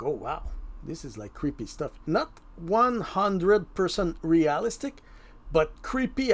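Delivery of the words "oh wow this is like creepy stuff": hushed awe. Retold or imitated. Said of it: "it's like".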